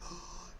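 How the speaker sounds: soft shocked gasp; sharp imitated intake of breath; mock gasp. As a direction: gasp as if spotting trouble. Acts out Darius seeing the gun.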